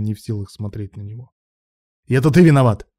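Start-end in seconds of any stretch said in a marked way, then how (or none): 2.1-2.9 loud, angry blame; yelling back. A narrator's reading. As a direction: yell the blame straight back. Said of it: "проорал в ответ Илья".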